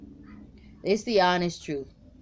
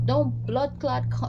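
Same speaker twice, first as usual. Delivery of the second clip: higher Jamaican patois curse; Jamaican accent; 6 semitones higher, 3 dB louder than usual. Pretend. Reader voicing the woman in Jamaican accent, cursing. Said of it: "using Jamaican".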